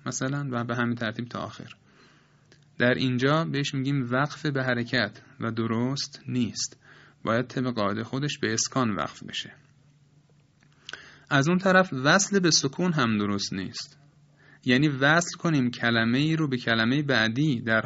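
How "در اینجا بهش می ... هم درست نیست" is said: ordinary delivery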